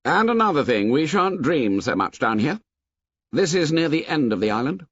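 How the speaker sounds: a hint of Jack laying down the law; Jack voiced. A narrator's reading. Jack telling the hunters to forget the beast, commanding.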